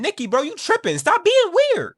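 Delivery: acted exasperated outburst, high and loud; standing up for himself. Bear finally stands up to Nikki, loud high outburst. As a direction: raise the voice, climbing to a high shout. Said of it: "Bear finally stands up for himself".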